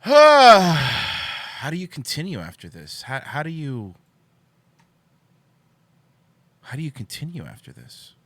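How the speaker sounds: slow hammed-up lament with a sigh; mock despair. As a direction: fake anguish, drawn out. Mock despair after reveal.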